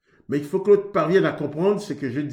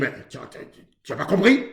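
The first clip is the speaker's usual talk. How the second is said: angry retort; faster than usual. Shown as a demonstration. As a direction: show the wrong, irritated way to answer. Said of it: "La colère".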